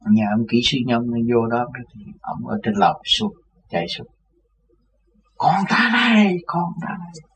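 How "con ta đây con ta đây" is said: put-on high, slow, crying old man's voice; an old man calling his son, weeping; tearful cry. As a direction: cry out with tears of joy. Old man runs down, embraces him.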